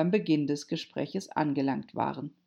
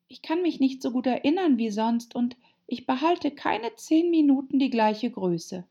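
put-on high-pitched girl character; Alice, earnest and high; polite Alice; 9 semitones higher, 3 dB louder than usual. A narrator's reading.

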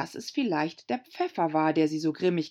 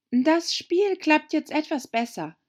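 put-on a higher girl's voice making small talk; Alice, polite; 11 semitones higher, 4 dB louder than usual. A narrator's reading. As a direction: keep the chat going politely. Alice macht Konversation. (Alice plaudert höflich, höher)

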